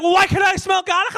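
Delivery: high accusing yell; mock wife shout. Wife shouting back at husband, high accusing mock yell. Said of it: "She was like".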